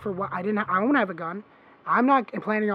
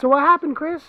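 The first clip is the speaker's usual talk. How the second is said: louder, pointed retold demand, hitting happened; confronting call-out; 7 semitones higher, 8 dB louder than usual. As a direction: call him out, half-raised voice.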